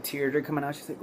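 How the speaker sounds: normal talking voice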